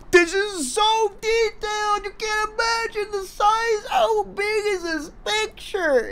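high, slow, mock-awed gushing; mocking awed commenter. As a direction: gush like a dazzled fan. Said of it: "people in the comments are like".

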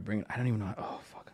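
normal talking voice

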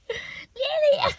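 high, loud cry of the name; exasperated call. Real, not acted. Owner exasperated with biting cat, high loud call. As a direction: cry out the cat's name, half laughing.

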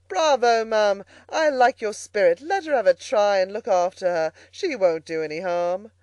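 loud, jovial encouragement; big, booming bravo easing off at the end; hearty approval. A narrator's reading. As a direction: cheer her on boisterously. Amused gentleman cheers her on.

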